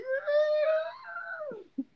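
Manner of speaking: very high, long drawn-out cry; a cocky guy strutting out with the drink; mock triumphant yell. Mocks guy walking out drinking.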